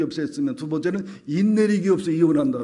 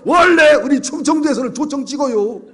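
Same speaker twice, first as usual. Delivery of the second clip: lawyer butting in; 10 semitones higher, 10 dB louder than usual. Retold or imitated. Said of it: "옆에 있던 변호사가".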